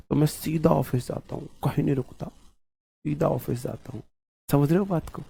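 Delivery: very soft, secretive undertone; hushed confiding. Acted. Hushed aside about being followed. (बहुत धीमी, दबी आवाज़ में)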